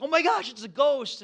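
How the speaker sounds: high, loud retold panic; hammed-up cry of alarm; mock freak-out. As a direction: blurt it like spooked disciples. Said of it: "the disciples start freaking out".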